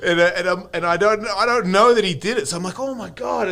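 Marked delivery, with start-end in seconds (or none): 2.8-3.5 high shocked gasp of words; stunned disbelief. Retold or imitated. Discovering the tattoo.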